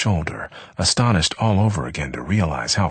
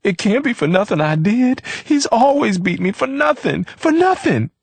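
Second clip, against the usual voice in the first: high, louder crying plea; tearful pleading; 4 dB louder than usual. A narrator's reading. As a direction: wail it through sobs, rising. Leona weeping after beating.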